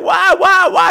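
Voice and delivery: high, loud hammed-up wail; mock wailing shout. Girl protests being thrown out with a mock shout. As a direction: wail the question at him, overdone. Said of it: "And she's like".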